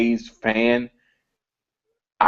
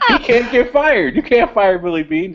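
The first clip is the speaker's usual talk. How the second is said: laughing; 11 semitones higher than usual. Real, not acted. Laughing while insisting.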